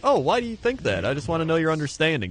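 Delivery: high, sweet mock-innocent question; poking a Twitter poster, mock innocent; innocent questioner. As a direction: ask it all wide-eyed. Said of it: "Just be like".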